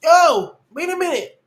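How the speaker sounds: high, loud shocked cry; startled outburst. Real, not acted. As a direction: recoil from the screen out loud. Recoils from a shocking image.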